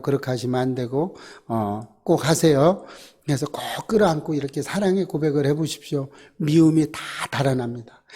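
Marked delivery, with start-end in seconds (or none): none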